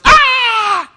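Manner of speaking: sudden high, loud "Ah!" shriek; startled shriek. Retold or imitated. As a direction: shriek in alarm at the sight of the eye. Daughter panics at dad's eye.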